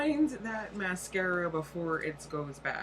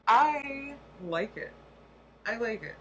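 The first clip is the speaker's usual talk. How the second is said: excited; 6 semitones higher, 6 dB louder than usual. Real, not acted. Drawn-out enthusiastic product praise.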